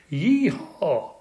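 high rodeo whoop; yelled cowboy whoop. A narrator's reading. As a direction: whoop like riding a bucking bronco. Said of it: "I yelled".

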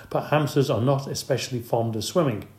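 ordinary delivery, nothing marked

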